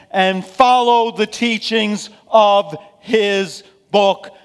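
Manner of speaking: loud, high, emphatic sermon delivery; forceful preaching. Real, not acted. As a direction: drive the lesson home from the pulpit, slowly and loudly.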